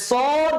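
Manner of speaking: সদ with heavy s and long vowel; thick sad. Shown as a demonstration. Teacher names the first twin letter, heavy সদ. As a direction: hold সদ thick and rounded. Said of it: "এটাও মোটা করে".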